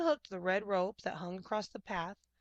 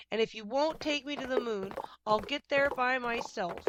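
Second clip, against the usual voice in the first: loud, high defiant cow cry; 5 semitones higher, 4 dB louder than usual. A narrator's reading. Cow Martha stampeding away, crying out. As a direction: shout it as you storm off. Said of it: "She cried".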